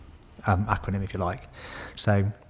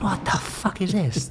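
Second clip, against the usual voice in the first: much higher, slow, incredulous; bewildered; 11 semitones higher, slower than usual. Retold or imitated. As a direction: replay his own disbelief at the ninja moves. Watching gloved man's ninja moves.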